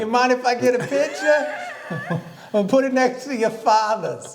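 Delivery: put-on old fan. Old fan asks for picture.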